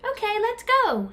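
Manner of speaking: high chirpy agreement; keen bird. A narrator's reading. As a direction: chirp yes to a friend. Pip agrees.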